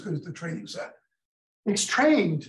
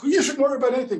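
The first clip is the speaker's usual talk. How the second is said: a higher, louder brushing-off reassurance; mock reassuring; 7 semitones higher, 5 dB louder, much faster than usual. The pundit brushing off the nervous AI bot, higher and louder. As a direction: wave off the bot's worry.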